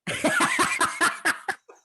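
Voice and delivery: a high, breathy burst of laughter. Real, not acted. Laughing at his own story.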